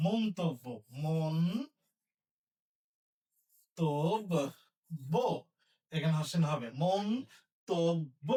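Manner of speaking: মন্তব্য as "montobbo", broken into slow syllables; correct, slowly spelled. Shown as a demonstration. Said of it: "তাহলে কি হবে?".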